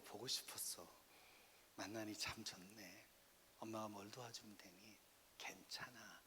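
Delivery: soft, low parent reassurance; tender positive words. Shown as a demonstration. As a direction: speak gently and slowly, almost whispering at the end. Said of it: "이런 긍정 언어를 쓰셔야 돼요".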